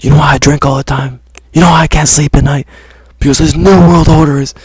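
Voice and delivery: high, loud ranting conspiracy host; Alex Jones impression. Mocking Alex Jones's rant to his viewers, loud high ranting. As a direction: rant it like a worked-up talk-radio host. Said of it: "the Alex Jones clip".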